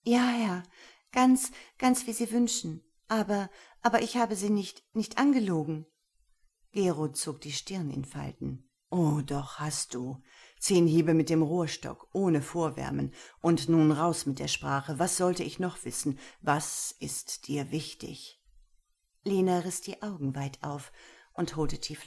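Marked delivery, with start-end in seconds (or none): 0.0-0.6 much higher, louder anxious yes; trembling. Acted. Lena answers Gero, trembling and high. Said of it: "Ihre Lippen bebten". 1.1-5.9 higher, louder, stuttering protest; anxious stammer. Acted. Lena protests to Gero she did not lie, flustered. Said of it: "Ihre Lippen bebten". 8.9-18.4 louder, cold sentencing voice; stern master. Acted. Gero sentences Lena, stern.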